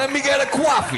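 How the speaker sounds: hammed-up New York accent. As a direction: order coffee like a Queens local. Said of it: "Let's do a good, like".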